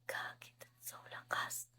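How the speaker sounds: whisper. Real, not acted. Streamer awed by big gift.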